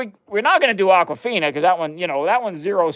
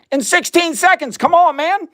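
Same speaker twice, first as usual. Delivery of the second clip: high, louder scoffing protest; incredulous; 12 semitones higher, 4 dB louder than usual. Real, not acted. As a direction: voice jumps up in disbelief. Scoffing at alkaline water claims.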